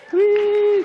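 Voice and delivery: very high, loud whoop of delight; a squeal of joy about the magazine cover; excited cheer. Real, not acted. Listener cheers the author's magazine cover with a high squeal.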